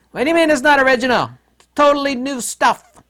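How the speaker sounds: high, loud whiny indignation; mock offended Buddhist. Mocking Buddhists who insist it is all new, high whiny voice. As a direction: play the outraged believer, squeaky and huffy.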